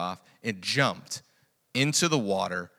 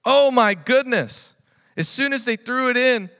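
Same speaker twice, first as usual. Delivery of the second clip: preaching at full force; 14 semitones higher, 14 dB louder than usual. Acted. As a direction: preach it out to the whole church.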